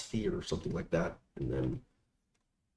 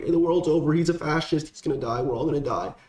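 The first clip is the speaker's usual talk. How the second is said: mock panicked leftist; 9 semitones higher, 4 dB louder than usual. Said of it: "people on the left are like".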